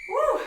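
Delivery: loud, high "woo" of relief; celebratory whoop. Real, not acted. Trainer finishing the last set with the class, celebratory whoop. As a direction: let out a big high whoop.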